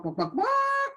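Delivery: high hammed-up clucks; chicken clucking. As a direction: play the chicken for the kids.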